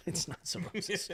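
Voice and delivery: soft, laughing reassurance; mild chuckling doctor; amused quiet dismissal. Retold or imitated. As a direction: brush it off gently.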